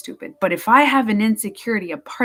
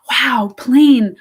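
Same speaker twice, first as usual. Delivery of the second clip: wide-eyed acted wow, pitched higher and louder; childlike wonder; 4 semitones higher, 6 dB louder than usual. A little child taking in everything, acted wonder. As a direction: marvel like a small child noticing things.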